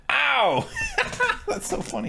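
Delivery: high, breaking into laughter; amused, squealing laugh. Real, not acted. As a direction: laugh through the words. Laughing at a brutal kill.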